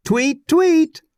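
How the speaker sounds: high, teasing bird chirp with a laugh; high giggly mock birdsong; laughing tweet. A narrator's reading. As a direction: tease them like a chirping bird. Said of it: "laughed Tack".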